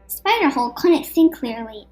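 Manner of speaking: ordinary delivery, nothing marked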